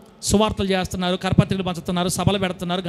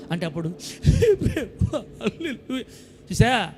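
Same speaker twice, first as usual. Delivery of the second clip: laughing; 5 dB quieter, much slower than usual. Real, not acted. Preacher laughs mid-sermon.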